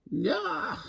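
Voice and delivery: high shrieked yah. A narrator's reading. Nightingale answering Alligator, high shriek. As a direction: shriek it back. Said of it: "shrieked Nightingale".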